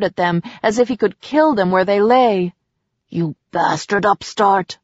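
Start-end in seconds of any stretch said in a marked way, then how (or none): 3.1-4.8 a contemptuous snarl at the upstart; snarled insult. Acted. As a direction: spit the curse out with scorn. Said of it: "the man snarled".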